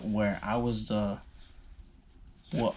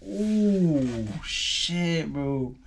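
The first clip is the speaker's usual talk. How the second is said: mock pleasure moan; 6 semitones higher, 4 dB louder, much slower than usual. Reliving sex, high exclaim.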